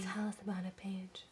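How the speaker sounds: hushed whisper. Real, not acted. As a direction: whisper it back. Whispered back after loud moment.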